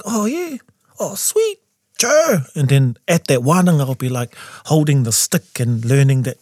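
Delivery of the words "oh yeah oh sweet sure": high-pitched retold "sure, sweet"; eager agreement. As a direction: act out keen, high agreement. Retells own reply to young people.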